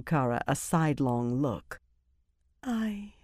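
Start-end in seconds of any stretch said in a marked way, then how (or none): 2.6-3.1 soft, high and tentative; said softly. A narrator's reading. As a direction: try the word out softly. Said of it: "she said softly".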